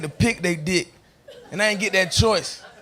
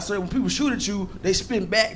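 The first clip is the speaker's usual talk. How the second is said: ordinary talk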